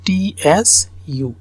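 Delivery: টি এস ইউ spelled letter by letter, slowly. Shown as a demonstration. Teacher spelling টি এস ইউ slowly for students. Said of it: "উচ্চারণটা".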